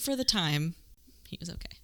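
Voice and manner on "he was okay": whispered under the breath; a hushed, hedging whisper; sheepish whisper. Real, not acted. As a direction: whisper it like a guilty aside. Hedging aside about the archaeologist.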